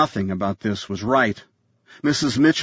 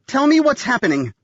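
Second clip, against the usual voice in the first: high, stressed near-panic; borderline panic; 13 semitones higher, 3 dB louder than usual. A narrator's reading. Nanny demanding answers from Sarah, borderline panic. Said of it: "the stress in her own voice".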